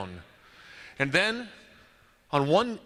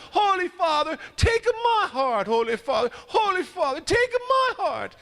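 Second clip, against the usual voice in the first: high, drawn-out pleading call; crying out; 13 semitones higher than usual. Acted. Man still crying up to the Pope for hours, high and pleading. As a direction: stretch each plea up toward the window. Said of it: "he's there for hours".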